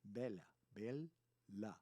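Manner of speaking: "Bella" split as bel-la; two L's, separated. Shown as a demonstration. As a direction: dwell on both L's in "Bella". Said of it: "Two L's".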